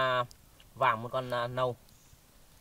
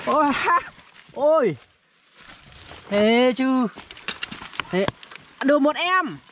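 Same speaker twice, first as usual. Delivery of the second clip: high, thrilled cries over a catch; excited; 10 semitones higher, slower than usual. Real, not acted. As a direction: burst out with joy at the trap. Bẫy dính chuột, reo mừng. (reo lên mừng rỡ)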